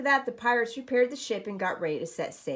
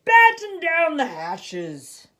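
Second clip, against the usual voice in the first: slow, higher, louder captain's bellow; shouted order; 9 semitones higher, 15 dB louder, much slower than usual. A narrator's reading. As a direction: roar it across the deck.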